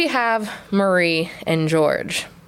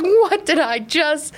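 slow, higher-pitched acted astonishment; stunned, drawn-out question; stunned disbelief; 6 semitones higher, much slower than usual. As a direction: stretch it out in retold shock. Retells shock at seeing ghost.

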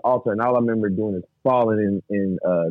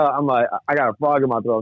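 ordinary talk, nothing marked

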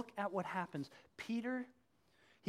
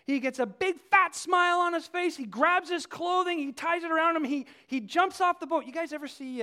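ordinary talk

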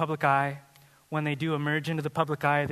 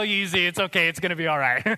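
ordinary talk

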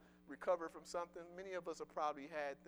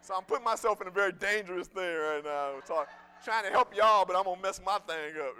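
much louder, driving pulpit voice; full-force preaching; 3 semitones higher, 15 dB louder than usual. Acted. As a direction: preach it out to the back row.

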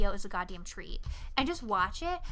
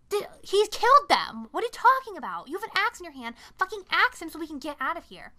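high-pitched, acted frantic scolding; exasperated urging; 6 semitones higher, 4 dB louder than usual. Molly begging Jerry to axe the killer, exasperated. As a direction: urge him on, exasperated and higher.

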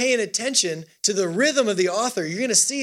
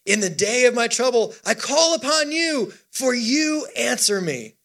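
psalm reading; 4 semitones higher than usual. Acted. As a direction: declaim the verse with long, raised stresses. Leads responsive psalm reading.

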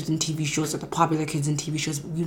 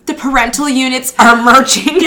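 quoted through laughter, louder and higher; laughing quote; 7 semitones higher, 11 dB louder than usual. Retold or imitated. Retelling Nicole's line to her cohost, laughing. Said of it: "Nicole goes".

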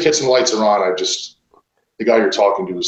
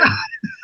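high squealing laugh; 4 dB quieter than usual. Real, not acted. Laugh mid-story.

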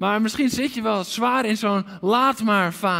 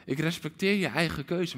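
much softer, tender; tender promise; 3 semitones lower, 7 dB quieter than usual. Acted. God softly assuring his wandering children. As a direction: reassure them gently. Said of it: "Want God zegt".

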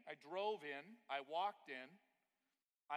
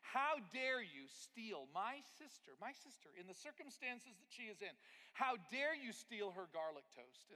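mock outrage; 6 semitones higher, 6 dB louder than usual. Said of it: "I'm mad at this point".

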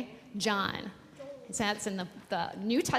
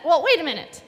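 loud, high storybook challenge; accusing man; 11 semitones higher, 11 dB louder than usual. A narrator's reading. Pastor voices a man questioning Peter for children, accusing. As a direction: challenge him loudly, voice climbing.

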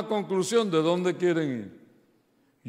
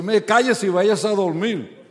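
louder, higher, exasperated telling-off; retold angry scolding, raised voice; fed-up outburst; 5 semitones higher, 7 dB louder than usual. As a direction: snap at her to go to bed. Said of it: "Ya estaba loco por decir".

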